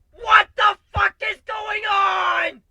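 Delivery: huge high slow shout; losing all restraint. Real, not acted. Narrator screaming in disbelief after the dragoness leaves. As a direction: explode in a drawn-out scream of disbelief. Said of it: "I lose all restraint".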